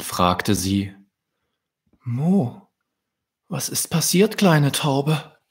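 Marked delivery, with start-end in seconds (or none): 2.0-5.4 higher, caring queen question; cow queen voice. Acted. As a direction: gently ask the little dove. Cow queen asks the dove.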